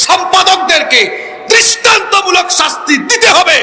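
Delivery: high, impassioned shout building to a demand; fiery high-pitched sermon oratory; preaching at full force. Real, not acted. Preaches, demanding punishment of authors.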